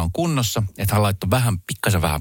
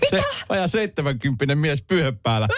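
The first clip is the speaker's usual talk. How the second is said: laughing; 6 semitones higher than usual. Real, not acted. Laughing while telling hotel story.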